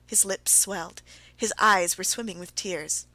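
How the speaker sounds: ordinary delivery, nothing marked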